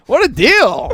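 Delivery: high, put-on enthusiasm; overdone awe at a radio ad; mock amazement. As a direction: gush over a bargain to tease. Baiting dad over radio ad.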